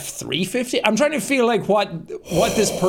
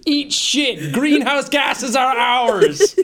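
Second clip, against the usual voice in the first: mock triumphant taunt; 6 semitones higher, slower than usual. Mock victory taunt continues.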